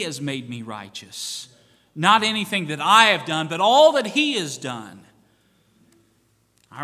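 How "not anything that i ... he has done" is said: full-voice shout, much louder and higher; fiery preacher's shout; preaching shout. Real, not acted. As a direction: let it ring out at the top of your voice.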